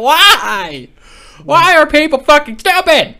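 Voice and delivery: loud, high-pitched outburst; exasperated shout. Real, not acted. Host reacting to stupid news, exasperated shout. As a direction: yell it in exasperation, stretching the first word.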